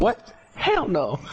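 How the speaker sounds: high shocked yelp of a brother; playful imitation of a shocked brother; mock brother outrage. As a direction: pitch it way up in disbelief. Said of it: "your brother was like".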